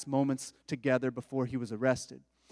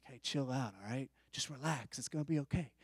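much softer, calming someone down; soothing hush; 3 semitones lower, 12 dB quieter than usual. Retold or imitated. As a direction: say it softly, like telling a worried friend to relax. Calming anxious questioners.

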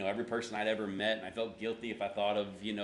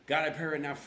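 louder, higher prayer that stalls; straining to pray; 5 semitones higher, 5 dB louder than usual. Shown as a demonstration. Pastor re-enacting failed prayer, straining for words. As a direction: push the prayer out, then jam. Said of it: "just can't get the words out".

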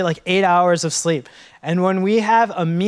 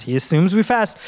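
usual speaking voice

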